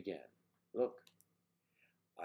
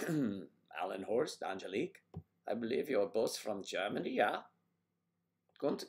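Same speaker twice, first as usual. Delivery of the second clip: hammed-up German accent from war films; 4 semitones higher, 4 dB louder than usual. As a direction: greet them in a comic German accent. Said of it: "my German accent comes from old war pictures".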